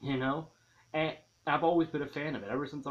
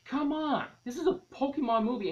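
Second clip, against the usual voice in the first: much higher and louder indignant outcry; exasperated rant; 13 semitones higher, 6 dB louder than usual. Real, not acted. Fan complains to viewers about missing battles, indignant outcry. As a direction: let the fan frustration burst out.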